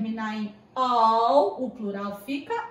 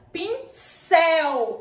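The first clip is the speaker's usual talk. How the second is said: "Pincel" with cel hit high and loud; stress on final syllable; 5 semitones higher, 6 dB louder than usual. Shown as a demonstration. Teacher showing where the stress falls, "Pincel" stressed on cel. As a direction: punch the last syllable of "Pincel", drawn out. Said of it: "a sílaba mais forte é o céu".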